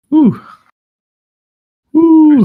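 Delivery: high exhaled whew, winding down; breathless whew after laughing. Real, not acted. Recovering from hard laughter.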